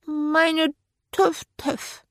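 put-on high, small-child babble; babbling toddler. A narrator's reading. Three-year-old Archie to godmother, tearful babbling. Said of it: "brabbelte Archie".

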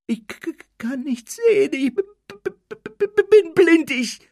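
very high, stuttering panic; fearful, broken squeal; terrified stammer. A narrator's reading. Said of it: "Dudleys angsterfüllte Stimme".

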